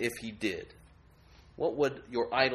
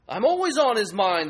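higher, louder, smug brag; portfolio idol; 8 semitones higher, 9 dB louder than usual. Acted. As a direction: preen like a proud idol. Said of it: "the portfolio says".

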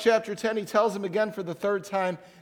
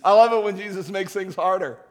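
mock churchgoer; 6 dB louder than usual. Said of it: "Don't, don't sit there and say".

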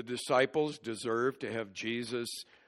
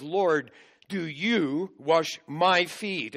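raised, slow, disbelieving question; shocked Peter; 5 semitones higher, 5 dB louder, slower than usual. Retold or imitated. Peter to Jesus at the basin, shocked and disbelieving. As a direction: sound stunned that the master would stoop. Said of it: "it shows Peter's shock".

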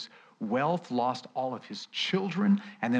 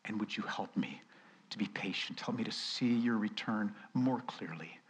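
softer, hushed plea for forgiveness; confessing prayer; 3 semitones lower, 8 dB quieter than usual. Pretend. Preacher voicing a quiet confession to God. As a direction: confess gently, almost a whisper. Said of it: "and say".

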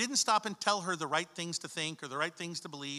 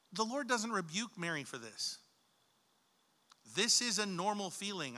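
normal talking voice

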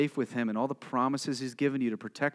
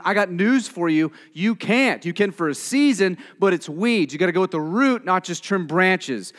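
louder, higher impassioned sermon drive; preaching at full force; 6 semitones higher, 9 dB louder than usual. Real, not acted. Preacher urging the congregation, preaching at full force. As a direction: preach it hard, pressing the point home.

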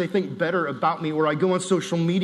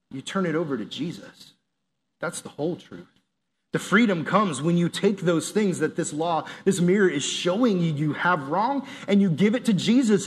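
normal talking voice

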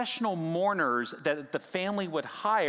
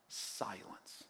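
whispered, almost soundless; hushed whisper; 7 semitones lower, 16 dB quieter than usual. Acted. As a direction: breathe the word out, almost no voice. Shows the sudden silence.

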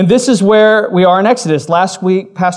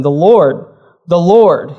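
both Lords stressed and a touch louder; emphatic repetition; 4 dB louder, slower than usual. Acted. Preacher reading Exodus to the church, stressing both Lords. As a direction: lean on each Lord for emphasis. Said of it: "he says it twice to add emphasis".

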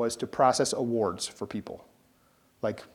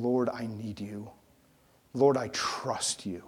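humble prayer; 4 semitones lower, 7 dB quieter, slower than usual. Shown as a demonstration.

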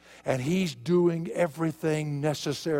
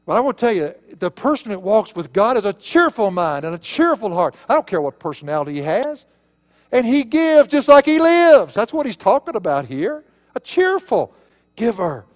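raised, forceful pulpit delivery; impassioned preaching; 5 semitones higher, 7 dB louder than usual. Real, not acted. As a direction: preach it hard, punching the key words.

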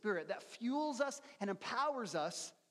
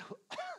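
a short cough to clear a frog in the throat; a quick rough cough; 5 dB quieter than usual. Real, not acted. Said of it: "I just got a frog in my throat".

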